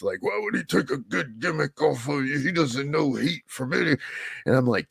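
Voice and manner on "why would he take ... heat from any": cussing through teeth. Retold or imitated. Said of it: "my mentor's cussing through his teeth".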